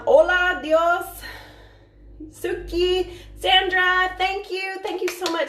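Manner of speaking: high, singsong shout-outs; high-pitched, delighted thanks; excited greetings. Real, not acted. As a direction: greet each friend brightly in a high voice. Greeting and thanking viewers by name.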